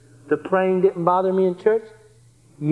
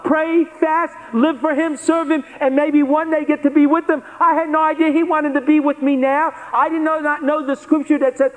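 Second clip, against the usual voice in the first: impassioned preaching; 7 semitones higher than usual. Acted. Preacher testifying to the congregation, impassioned and high. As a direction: preach it with rising fire. Preaches his conversion at rising pitch.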